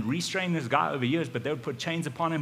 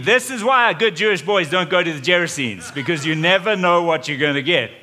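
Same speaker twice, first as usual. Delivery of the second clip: loud, higher-pitched alarmed complaint; panicked outburst; 4 semitones higher, 11 dB louder than usual. Retold or imitated. Frightened disciple reacting to the apparition, acted loud panic. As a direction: blurt it out loudly in mock panic.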